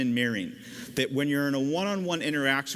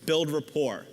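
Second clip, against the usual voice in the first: normal talking voice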